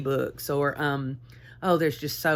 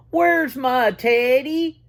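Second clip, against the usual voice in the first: higher, slow, louder whine; a big bear whining for his teddy; 7 semitones higher, 4 dB louder, slower than usual. A narrator's reading. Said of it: "A great big voice whined".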